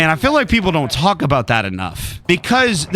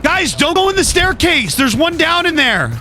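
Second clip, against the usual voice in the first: higher urgent call to the team; urgent warning; 10 semitones higher than usual. Real, not acted. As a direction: warn them off fast.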